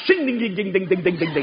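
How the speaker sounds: rapid ringing "shing, ding-ding" chant, falling away; imitated chant. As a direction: rattle off a metallic string of dings. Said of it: "One guy went".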